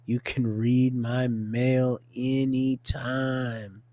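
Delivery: "read my mail anytime" drawn right out; half speed, savoring every word; slow emphatic repeat. Acted. Repeats the quote slowly for emphasis.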